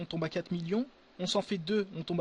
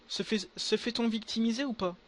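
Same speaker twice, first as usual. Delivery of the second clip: ordinary talk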